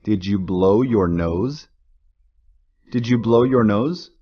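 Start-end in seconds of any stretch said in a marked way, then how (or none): none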